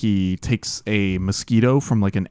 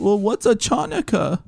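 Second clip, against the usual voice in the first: cartoon baby's question; 10 semitones higher than usual. Baby answering the bossy girl about Hanukkah, high cartoon impression. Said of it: "And then he goes".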